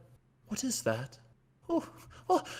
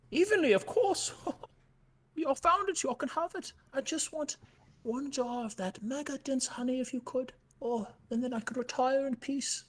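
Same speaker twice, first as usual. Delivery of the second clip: put-on a lightly higher, sweet old bear; bear NPC voice; 5 semitones higher than usual. Winnie answers the panda about the cut, in a higher voice. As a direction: answer gently like a retiring bear.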